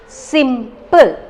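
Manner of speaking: "Simple" in two clear units; two separate syllables. Shown as a demonstration. Teacher showing students the two units, separated syllables. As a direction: say "Simple" as two distinct beats. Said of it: "the two unit is coming".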